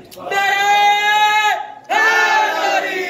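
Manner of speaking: rallying cry. Real, not acted. Preacher calls the slogan to the gathering, drawn-out shout. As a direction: call the slogan out long and loud.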